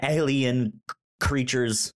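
usual speaking voice

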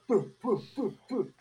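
high little boo puffs mimicking smoke blown out; mock puffing sounds. Imitating a guy puffing smoke, high boo puffs. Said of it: "So Momo impersonates him".

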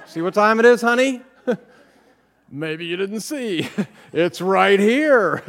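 high, loud, sugary-sarcastic honey; sarcastic wife-nagging. Retold or imitated. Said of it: "I've perfected this art".

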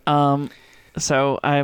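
usual speaking voice

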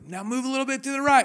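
high, loud, quick direction; 1 teacher calling up. Retold or imitated. Teacher talking a student down the mountain, loud. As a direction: shout the next step up to him. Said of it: "keeps talking me through it".

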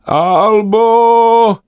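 high, loud call from afar. Acted. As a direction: shout her name across the sky. Sister calls out again. (vysoké hlasité volání sestry)

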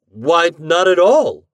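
stammering. A narrator's reading. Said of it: "I stammered".

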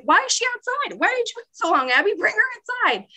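very high, playful urgent questioning; eager mock exasperation. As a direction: pretend to scold her for keeping a guest waiting. Retold eager demand to bring friend in.

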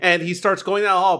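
ordinary talk, nothing marked

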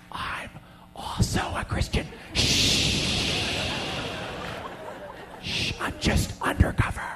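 soft unvoiced conspiratorial whisper. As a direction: whisper like an undercover agent.

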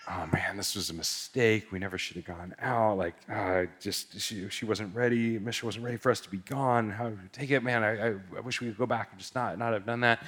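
soft, low, deflated self-reproach; subdued, second-guessing what they did; regretful husband. Retold or imitated. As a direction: imitate a man quietly kicking himself.

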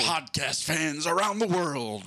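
ring announcer voice. Acted. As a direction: stretch every word like a boxing announcer. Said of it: "I'm doing my ring announcer voice".

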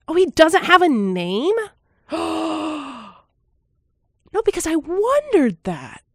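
high, real gasping surprise; real gasp of discovery; shocked discovery. Real, not acted. Finding out the narrator has no name, genuinely shocked.

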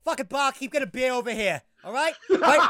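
a hammed-up South Boston bar shout; Southie accent impression. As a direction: play a rowdy Boston guy calling his buddy. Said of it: "what's an example of a Southie accent".

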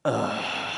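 a low, reluctant groan of a dad; a heavy unwilling ugh. Retold or imitated. A father groaning at the proposal request, low and reluctant. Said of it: "that was literally the sound".

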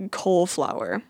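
"cauliflower" said as COLE-flower; disputed pronunciation. Shown as a demonstration. Vlogger quoting the video guy, cauliflower as Cole-flower. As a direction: make "cauliflower" two words, Cole plus flower. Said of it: "the name Cole and then flower".

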